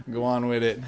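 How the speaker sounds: playful imitation Jamaican patois; mock Caribbean lilt between giggles; Caribbean accent. Cracking up at own accent joke, mock Caribbean accent. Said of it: "That's a great accent".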